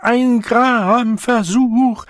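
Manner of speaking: very high, loud, stretched wail; frantic, jolted pleading; shaken quaver. A narrator's reading. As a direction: wobble the words as if being shaken. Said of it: "ihre Stimme bebte lächerlich".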